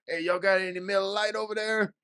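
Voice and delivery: put-on high, loose drunken call; drunk friend voice. Drunk friend asking for beer.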